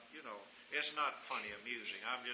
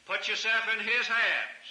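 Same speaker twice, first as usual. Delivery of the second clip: high, slow and loud; a high held preaching cry; loud plea; 9 semitones higher, 13 dB louder, slower than usual. Real, not acted. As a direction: call the people into His hands.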